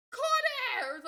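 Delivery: very loud, high shout; angry seated man yelling at her. Retold or imitated. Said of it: "he yelled at me".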